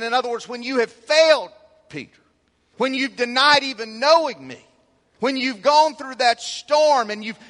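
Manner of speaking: high, loud, emphatic pulpit delivery; full-force preaching. Acted. Preacher exhorting as Jesus speaking to Peter, full-force preaching. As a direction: preach it hard, leaning on the key words.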